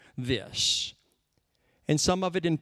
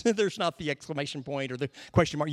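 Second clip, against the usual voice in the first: ordinary talk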